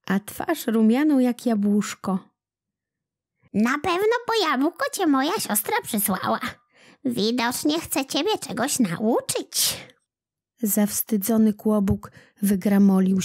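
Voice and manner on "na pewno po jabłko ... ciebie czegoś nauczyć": put-on a kind old woman musing aloud. A narrator's reading. Old woman speaks to Kłobuk.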